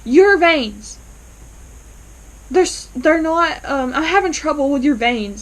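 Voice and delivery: doctor stalling about the patient's veins, stammering high; stammering excuse. Retold or imitated. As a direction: fumble for an excuse. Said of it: "He's like".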